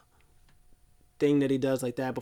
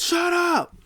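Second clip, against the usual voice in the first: much higher, louder bark; mock yell; 6 dB louder than usual. Brock silencing Heyman, hammed-up yell. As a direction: snap it out as a yell. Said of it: "he says".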